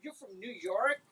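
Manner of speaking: taken aback, quiet and questioning; a retold, hushed double take; stunned disbelief. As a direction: ask it like you just stepped back in shock. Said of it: "five steps back".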